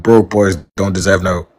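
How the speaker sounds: ordinary delivery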